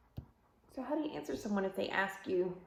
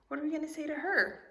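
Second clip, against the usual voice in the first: usual speaking voice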